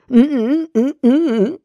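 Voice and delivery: muffled high mm sounds of protest. A narrator's reading. Bella trying to protest into the sleeping bag, muffled mm sounds. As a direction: protest with closed lips, high and pressed. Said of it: "versuchte ich zu protestieren".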